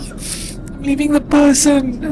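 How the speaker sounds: tearful, high and loud, breaking; crying, worn out. Real, not acted. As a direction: sob it out, heartbroken. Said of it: "I literally can't stop crying".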